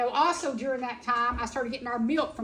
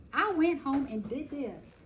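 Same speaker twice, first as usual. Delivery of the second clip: normal talking voice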